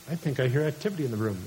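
fast, low, quiet remark; suspicious dad aside. Retold or imitated. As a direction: murmur it quickly to yourself. Dad overhears daughter out of bed.

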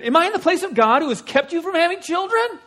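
angry high-pitched scream; hammed-up outraged shouting, punched last word; Jacob. Jacob screaming back at his wife, angry and loud. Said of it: "He got angry and he screamed at his wife".

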